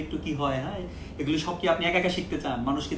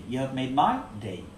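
slow model phrase; 4 semitones lower, much slower than usual. Shown as a demonstration.

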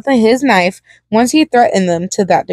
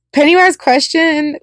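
narration pitched up high; higher misread; 7 semitones higher than usual. A narrator's reading. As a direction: read the tag in a higher voice. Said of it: "Pennywise questioned with one of".

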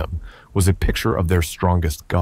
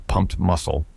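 ordinary delivery

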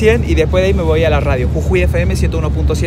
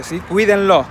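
ordinary talk, nothing marked

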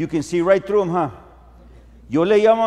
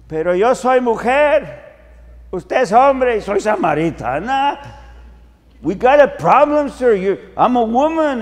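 louder, higher indignant woman; protesting Samaritan woman; 5 semitones higher, 5 dB louder than usual. Acted. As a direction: object loudly, a touch scandalised. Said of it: "Samaritan woman answers Jesus". (samaritana indignada, más fuerte y aguda)

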